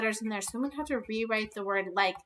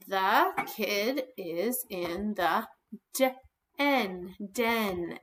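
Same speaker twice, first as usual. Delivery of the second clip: slow, word-by-word reading; slow dictation; 3 semitones lower, much slower than usual. Shown as a demonstration. Teacher rereading the sentence slowly for kindergarteners. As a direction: read each word slowly as you write it. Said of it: "slow rereading while writing".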